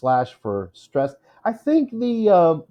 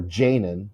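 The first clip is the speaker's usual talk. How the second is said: "Janin" as JAY-nin; long stressed JAY in "Janin", weak ending; misstressed "Janin", JAY-nin; stress on first syllable; 3 semitones lower than usual. Shown as a demonstration. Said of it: "it's not Janin".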